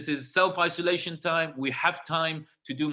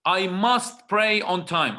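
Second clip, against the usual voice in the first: slow, with MUST stressed, "I must pray on time"; firm self-reminder; 3 semitones higher, 5 dB louder, slower than usual. Shown as a demonstration. Preacher telling his own soul, firm and slow. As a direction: lean hard on "must", slowly, as a vow to yourself. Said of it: "I'm talking to my soul here".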